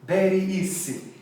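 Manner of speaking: very easy as beh-ree EE-see, Spanish accent. Shown as a demonstration. Teacher repeating the imitation, Spanish accent.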